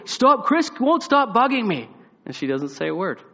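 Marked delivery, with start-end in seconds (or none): 0.0-1.9 put-on loud, high kid whine; boy begging grandma to stop his brother, loud whine; whiny plea. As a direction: beg grandma to make him stop. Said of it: "I was like".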